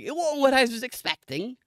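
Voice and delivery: high put-on woman's voice; mock female contestant. Woman answering the host about the odd voice, high mock voice. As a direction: imitate her, pitched well up. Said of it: "And she's like".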